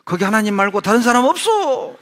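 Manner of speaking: high, loud yell breaking into a laugh; laughing mock yell from the cliff; comic shout. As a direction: yell for anyone but God. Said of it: "뭐라고 소리 지른 줄 알아요".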